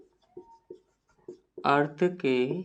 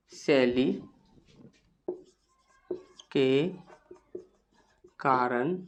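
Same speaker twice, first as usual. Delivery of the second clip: low, soft dictation with long gaps; quiet, measured note-taking voice; slow dictation; 6 semitones lower, 8 dB quieter, much slower than usual. Shown as a demonstration. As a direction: dictate word by word, leaving long pauses.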